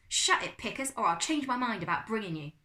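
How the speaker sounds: loud, high opening snap back; arguing. A narrator's reading. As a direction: retort loudly in a squabble. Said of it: "cousins arguing".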